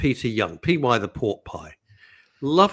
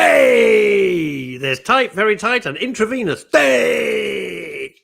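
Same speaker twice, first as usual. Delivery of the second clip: high, loud shouted catchphrase; comic shout; 9 semitones higher, 8 dB louder, much slower than usual. Acted. Co-host capping a tight trousers joke, loud high shout. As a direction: bellow it high like a music-hall gag.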